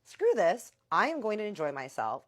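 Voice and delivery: loud, high burst of defiance; defiant outburst. Retold or imitated. Deciding at the party to just enjoy herself, loud and defiant. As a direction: throw caution away, out loud.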